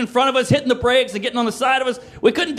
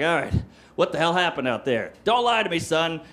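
lightly voiced cop, mostly lower and measured; police officer; 6 semitones lower, 4 dB quieter than usual. Police questioning teens afterwards, lightly voiced officer. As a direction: question it as a sober authority.

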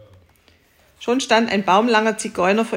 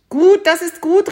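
viel höher und lauter jubeln; freudiger Ruf; 9 semitones higher, 7 dB louder than usual. Acted. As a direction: begeistert zustimmen. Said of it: "rief der Bauer". (laut jubelnde, hohe Zustimmung)

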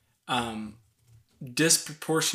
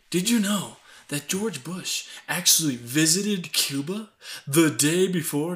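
dramatic documentary narrator; 5 semitones higher than usual. Retold or imitated. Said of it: "and, like".

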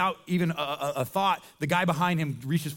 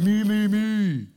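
slow, louder mock me-me-me; self-absorbed whine; 3 semitones higher, 6 dB louder, much slower than usual. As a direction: drag it out like a spoiled child demanding attention. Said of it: "self-absorbed".

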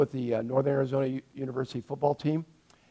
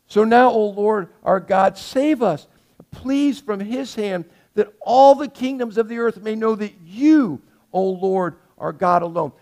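fervent prayer; 3 semitones higher, 6 dB louder than usual. Acted. Preacher reads Hezekiah's prayer aloud.

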